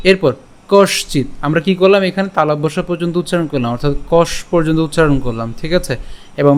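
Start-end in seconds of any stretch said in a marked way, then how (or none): none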